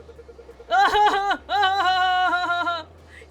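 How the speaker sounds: big loud cackle, high; helpless loud howl of laughter; loud burst of laughter. Real, not acted. Laughing at silly ride.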